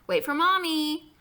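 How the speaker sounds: put-on high, loud call after a toddler; hollering mother. A narrator's reading. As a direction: holler it drawn-out.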